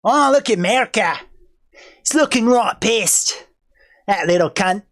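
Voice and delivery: mock Aussie taunting; Australian accent. Aussie space force taunting America, cocky Australian accent. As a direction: goad them in a broad Australian accent. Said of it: "My, my Australian accent is fucked".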